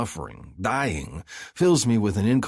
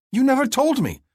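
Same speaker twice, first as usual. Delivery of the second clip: high, loud, told pushed down hard; furious accusation; 7 dB louder than usual. A narrator's reading. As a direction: spit it at him. Angry argument.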